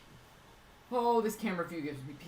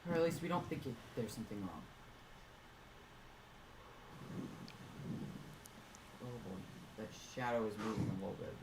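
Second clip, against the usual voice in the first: much softer and lower, worried; worried murmur; 9 semitones lower, 15 dB quieter than usual. Real, not acted. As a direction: hush with concern. Worried, watching the shadow.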